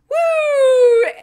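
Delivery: loud, high, drawn-out whoo; triumphant whoop. Acted. Dazai crowing that he survived, triumphant whoop.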